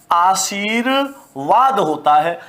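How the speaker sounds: आशीर्वाद said slowly, each sound clear; slow careful correct reading. Shown as a demonstration. Teacher to class, careful slow correct reading. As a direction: spell out आशीर्वाद syllable by syllable. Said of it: "क्या होता है".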